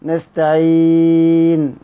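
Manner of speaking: نستعين with the ee stretched to four counts; medium madd. Shown as a demonstration. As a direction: draw out the ee of نستعين, medium length. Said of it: "كسر توسط مد". (نستعين بالتوسط)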